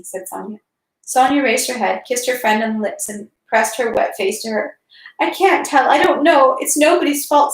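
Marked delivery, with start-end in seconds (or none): 5.2-7.5 higher, tearful pleading. A narrator's reading. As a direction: speak through tears like a hurt child. Natasha sobbing.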